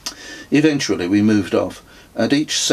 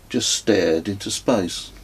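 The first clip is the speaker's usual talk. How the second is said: ordinary delivery, nothing marked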